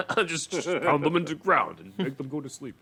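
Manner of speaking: dim tough-guy imitation; mock Batman voice. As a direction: play Batman as a brute. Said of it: "This is what's in the movie".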